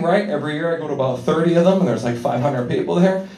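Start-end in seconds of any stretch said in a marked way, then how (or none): none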